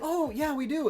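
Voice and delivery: imitating store lady. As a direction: chirp it like a cheerful clerk, pitch way up. Retells the store lady answering.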